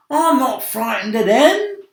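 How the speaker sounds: high, loud, cocky centipede; defiant shout. Acted. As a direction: shout it to show you're not scared. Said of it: "shouted the centipede".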